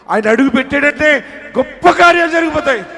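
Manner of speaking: high, loud prophetic proclamation; full-force preaching. Real, not acted. Preacher promising the troubled that justice is coming, full-force proclamation. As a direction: proclaim it at the top of your preaching voice.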